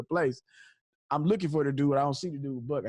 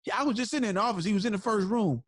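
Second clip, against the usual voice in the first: retold yell; 6 semitones higher than usual. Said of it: "So he yelled on the phone".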